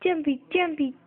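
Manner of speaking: usual speaking voice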